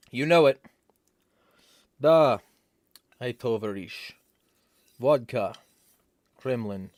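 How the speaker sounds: hammed-up Russian accent, loud on Da; playful fake Russian accent, word by word; mock Russian accent. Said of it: "Other stuff in Russian accent".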